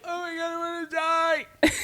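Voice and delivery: high, squealed lament; despairing wail. Retold or imitated. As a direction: whine it in mock despair. Retells own despair.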